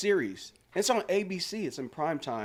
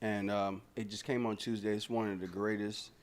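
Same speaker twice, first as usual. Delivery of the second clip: mild promo read; 7 semitones lower, 8 dB quieter than usual. Acted. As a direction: plug the show like an ad, lightly. Plugs a TV series.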